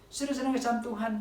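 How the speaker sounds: ordinary delivery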